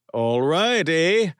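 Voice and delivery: put-on loud, high Canadian accent; hammed-up Canadian cheer, eh; thick Canadian accent. A narrator's reading. Sonny revving up his friends in a thick Canadian accent. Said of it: "in a thick Canadian accent".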